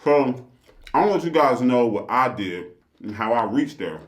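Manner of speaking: ordinary delivery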